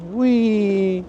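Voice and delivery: high playful whee; silly whee. Pretend. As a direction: squeal it like a kid. Playful exclamation.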